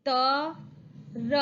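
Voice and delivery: ordinary talk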